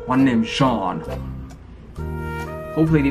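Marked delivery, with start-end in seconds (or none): none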